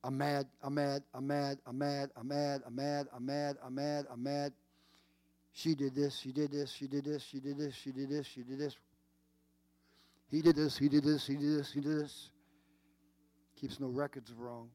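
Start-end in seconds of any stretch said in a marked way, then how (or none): 0.0-4.5 monotone loop of identical repeats; same tune every time, no variation; skipping record. Acted. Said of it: "your record is skipping". 5.5-8.8 monotone mock grievance on repeat; skipping record. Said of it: "your record is skipping". 10.3-12.3 stuck-record accusation, punched at the start; mock grievance on a loop; skipping record. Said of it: "your record is skipping".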